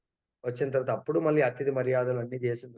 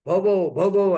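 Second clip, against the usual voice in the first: imitated barking, high and loud; loud mock woof woof; dog bark; 5 semitones higher, 8 dB louder, much slower than usual. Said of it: "అంటుంది కుక్క".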